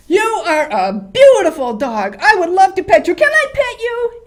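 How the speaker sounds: high, booming shout at a dog; overexcited yelling, much too loud; really loud. Shown as a demonstration. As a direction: gush at the dog far too loudly. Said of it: "And you got really loud with him".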